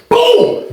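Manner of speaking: high cartoon impact noise; high "boom". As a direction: pop out a high, booming slam effect. Imitates body slam.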